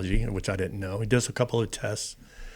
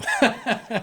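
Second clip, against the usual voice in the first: burst of laughter; 13 semitones higher than usual. Real, not acted. Laughs at own joke.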